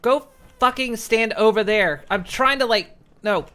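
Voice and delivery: loud, exasperated shouting; angry yelling. Real, not acted. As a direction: yell at them in real annoyance. Still yelling at companions.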